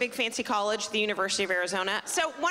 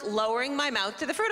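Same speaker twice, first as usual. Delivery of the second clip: ordinary talk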